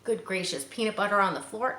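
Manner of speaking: ordinary delivery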